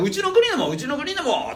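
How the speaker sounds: eager begging. Retold or imitated. Lords begging for more.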